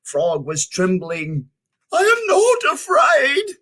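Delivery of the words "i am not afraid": much higher and louder, forced bravado; brave shout. Acted. Frog shouts at the rocks, high forced bravado. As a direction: yell it to prove you are not scared. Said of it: "he shouted".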